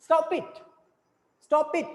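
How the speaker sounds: sharp falling command on STOP with a weak "it"; stress on STOP. Shown as a demonstration. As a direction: punch "Stop", let "it" fall away. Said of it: "stop को तो आप ज्यादा तेज से बोल रहे हैं".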